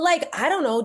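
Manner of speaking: ordinary talk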